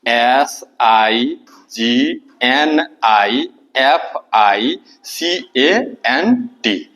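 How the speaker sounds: letters dictated very slowly, spaced apart; slow classroom dictation; slow spelling out. Shown as a demonstration. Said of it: "significant use कर सकते हैं".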